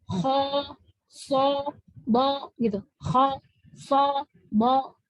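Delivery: heavy open vowels on "kho, so, bo"; half-rounded thick vowels. Shown as a demonstration. Teacher showing the correct shape, half-rounded thick letters. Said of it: "Tapi dia bentukannya seperti".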